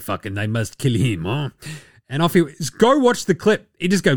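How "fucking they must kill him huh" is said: lower, softer mock French accent. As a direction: mutter it like a caricature Frenchman. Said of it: "all accents and vocalizations approximate".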